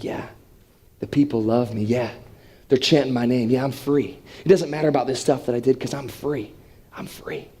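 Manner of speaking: low, hushed self-talk; Barabbas savoring freedom. Acted. Freed prisoner walking away, hushed low realization. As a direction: let it sink in softly, fading to near whisper. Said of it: "picture Barabbas in this moment being like".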